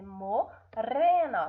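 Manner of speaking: "morena" slowly, with a rolled R; tongue-tip trill in "morena"; weak trembling R. Shown as a demonstration. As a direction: pause "mo-rena" and trill the R. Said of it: "ó, tremeu".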